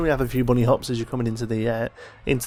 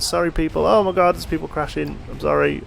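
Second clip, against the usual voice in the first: higher, louder, sudden alarm at the crash; startled apology; 5 semitones higher, 4 dB louder than usual. Real, not acted. Player watching test train hit guests, startled apology. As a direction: blurt out a shocked sorry.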